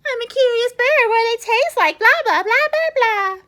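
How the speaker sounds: mocking mimicry. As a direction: mimic the duckling to make fun. Pigeon mocks the duckling.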